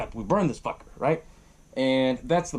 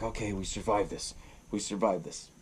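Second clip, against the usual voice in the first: soft, low, drained murmur; hushed relief; 6 semitones lower, 8 dB quieter than usual. Retold or imitated. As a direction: say it quietly, as a worn-out survivor. Retold survivors paddling away.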